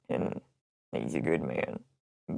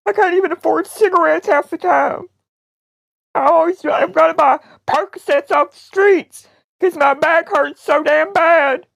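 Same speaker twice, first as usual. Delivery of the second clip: mock-tearful lament; 2 semitones higher, 4 dB louder than usual. Prank caller lamenting money and pain, tearful. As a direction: lay on the fake misery.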